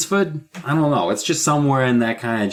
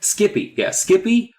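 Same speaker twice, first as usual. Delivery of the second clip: mock delight; 11 semitones higher, slower than usual. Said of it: "mocking the dog names".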